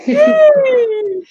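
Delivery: a high loud laughing yay; laughing cheer. Real, not acted. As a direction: cheer out in relief and joy. Overwhelmed joy after session.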